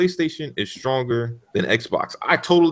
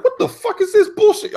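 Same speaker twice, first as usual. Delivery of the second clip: mock outrage. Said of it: "I'm like".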